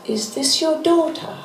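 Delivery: lightly voiced [name].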